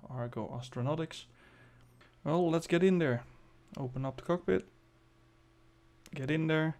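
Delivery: ordinary delivery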